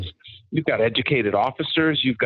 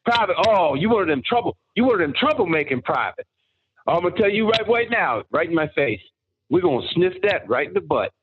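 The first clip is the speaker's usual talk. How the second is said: higher mock drill-sergeant yell, lightly done; a drill sergeant mangling his words at a recruit, higher mock yell; 6 semitones higher than usual. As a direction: ham up the garbled drill sergeant tirade. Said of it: "what he said was".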